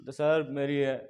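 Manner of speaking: low, halting mock whimper; mock tearful. Injured boy answers the ticket checker, mock tearful. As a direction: imitate a boy tearfully owning up. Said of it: "रोने लग गया लड़का".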